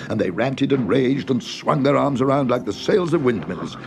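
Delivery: plain reading voice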